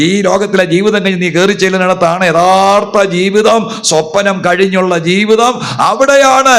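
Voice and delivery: high, loud fervent sermon; fervent pulpit preaching. Real, not acted. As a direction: preach at full force. Full-force preaching.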